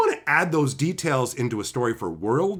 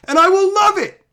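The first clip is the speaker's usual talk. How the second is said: high and loud; emphatic; 9 dB louder than usual. Real, not acted. Vlogger insisting he'd love good representation, high and loud. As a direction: declare it with real gusto.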